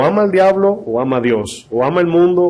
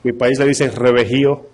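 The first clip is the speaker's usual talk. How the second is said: ordinary talk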